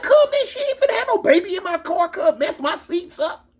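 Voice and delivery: high, squealing protest; retold panic over the car; acted indignant outburst.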